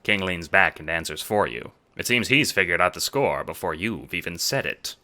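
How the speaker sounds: ordinary talk, nothing marked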